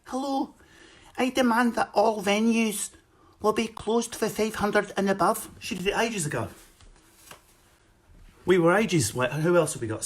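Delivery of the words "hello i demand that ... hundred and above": high, Scottish politician impression; little leader making Scottish demands, pitched up; Nicola Sturgeon. Scottish leader demanding venues close, high mock accent. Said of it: "Nicola Sturgeon's down there".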